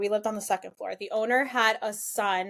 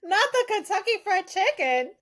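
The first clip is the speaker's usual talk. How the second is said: incredulous; 10 semitones higher than usual. Real, not acted. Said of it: "disbelief at the comparison".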